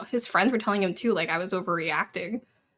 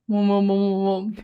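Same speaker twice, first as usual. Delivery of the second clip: mock mumbled syllables, a little louder and run together; imitating mumbling; 5 dB louder than usual. Cashier mimics a masked boy mumbling his order. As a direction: lazily mumble the sounds like a teen boy in a mask. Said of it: "So all I hear is".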